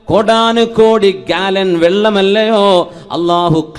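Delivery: loud, high-pitched sermon delivery; impassioned preaching. Real, not acted. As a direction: preach it out in ringing, sustained phrases. Preaches to congregation.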